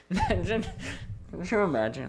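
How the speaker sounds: ordinary talk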